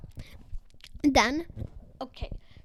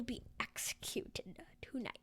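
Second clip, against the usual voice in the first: unvoiced sinister whisper; whispered threat; 13 dB quieter than usual. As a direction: breathe the threat in a hush. Alien's threat translated.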